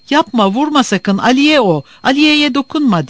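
high, urgent plea to stop the kick; pleading. A narrator's reading. Divitoğlu begging Murat not to kick the cat, pleading high and loud. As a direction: beg him not to hurt the cat. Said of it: "dedi yalvarır gibi".

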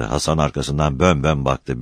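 ordinary delivery

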